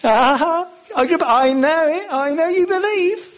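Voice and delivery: high, playful and teasing; cheeky winsomeness. Shown as a demonstration. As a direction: tease him into it with a grin. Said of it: "a little bit of cheeky winsomeness".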